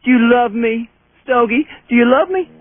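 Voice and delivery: pet talk. Real, not acted. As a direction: promise a treat sweetly. Coaxing with treat.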